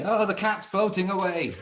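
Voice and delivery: high, laughing imitation of a yell; mock yell. Retelling his wife yelling about the cat, high mock yell. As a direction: play the wife's cry, half laughing. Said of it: "She's yelling".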